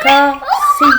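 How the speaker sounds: laughing shout. Real, not acted. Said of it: "laughing shout".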